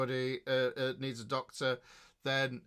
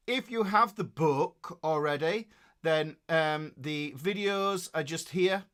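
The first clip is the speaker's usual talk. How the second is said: ordinary talk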